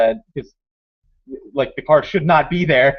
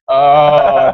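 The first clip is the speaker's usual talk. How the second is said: long, loud "oh" of understanding; retold realisation; 9 dB louder than usual. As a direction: act out the penny dropping.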